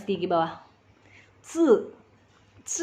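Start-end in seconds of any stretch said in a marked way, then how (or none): 1.4-1.6 "C" as ts, tongue behind teeth, no puff; tongue at the teeth, hold air back on "C"; dental Z. Shown as a demonstration. Teacher giving the Z example, unaspirated. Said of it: "Di antara gigi atas". 2.7-2.8 "C" as ts, tongue behind teeth, no puff; tongue at the teeth, hold air back on "C"; dental Z. Shown as a demonstration. Teacher giving the Z example, unaspirated. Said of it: "Nah, itu Z".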